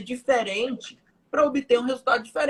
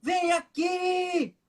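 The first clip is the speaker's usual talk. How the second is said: high-pitched, drawn-out mock yell; child's yell; 7 semitones higher, much slower than usual. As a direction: imitate a toddler calling Papai, stretching each word. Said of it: "assim, ele gritou".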